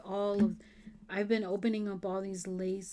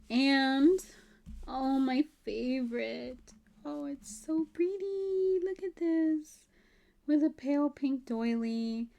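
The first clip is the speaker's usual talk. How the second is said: higher, slower, gushing over her favourite; excited gushing; 6 semitones higher, 4 dB louder, slower than usual. Real, not acted. Opening her favourite piece for viewers, gushing excitedly. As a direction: coo over it like a treasure.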